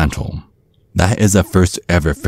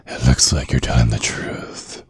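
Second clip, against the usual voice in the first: breathy whisper, close and low; creepy whisper; 4 semitones lower, 5 dB quieter than usual. Acted. Dad inspecting the helpless date, menacing whisper. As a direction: whisper it as a verdict. Dad checks date's body.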